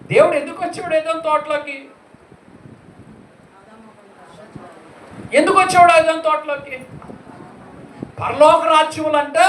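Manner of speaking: high, loud and fast repeated questioning; fervent preaching. Acted. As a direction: drive the question home to the crowd. (ఉత్సాహంగా ప్రశ్నించడం)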